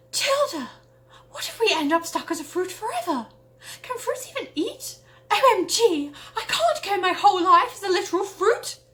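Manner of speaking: put-on high, loud, frantic girl voice; panicky friend. Rachel panicking to Matilda about becoming fruit, high frantic squeal. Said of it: "she cried".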